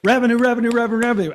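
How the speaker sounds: loud, high rapid-fire repeating; excited repetition. Real, not acted. Host excitedly shouting the quiz answer to the audience. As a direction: blurt it out eagerly like a quiz answer.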